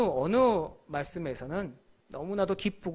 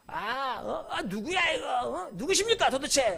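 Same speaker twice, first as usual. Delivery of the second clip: defiant shout; 7 semitones higher, slower than usual. Shown as a demonstration. Powerful Saul shouting back at the blinding voice, furious and defiant. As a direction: bark it like a proud man who bows to no one. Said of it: "고분고분하게 얘기 안 했을 거예요".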